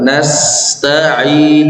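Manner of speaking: Nasta'in with the S hissing on until it finishes; flowing S. Shown as a demonstration. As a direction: let the S in Nasta'in flow out fully. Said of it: "biarkan mengalir dulu".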